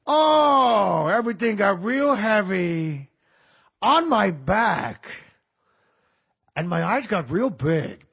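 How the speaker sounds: heavy, very slow Heat-era Pacino; mock weary late Pacino; later Pacino. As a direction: drag the words out like they weigh a ton. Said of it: "somewhere around Heat".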